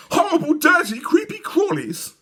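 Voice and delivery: shudder at the bugs; high squealing girl; squealing disgusted girl. A narrator's reading. Dawn disgusted.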